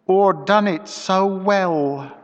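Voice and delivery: slow and deliberate, every word weighed; measured slowness. A narrator's reading. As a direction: let each word drop slowly. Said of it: "with measured slowness".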